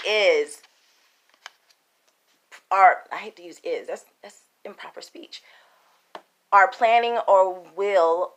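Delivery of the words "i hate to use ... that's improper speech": muttered aside. Real, not acted. Muttered self-correction aside.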